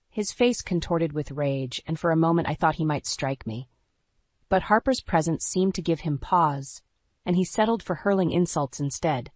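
ordinary delivery, nothing marked

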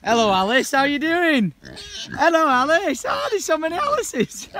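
loud, high greeting to animals; calling out. Real, not acted.

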